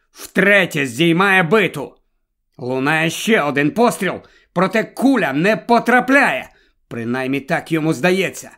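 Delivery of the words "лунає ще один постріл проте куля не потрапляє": ordinary delivery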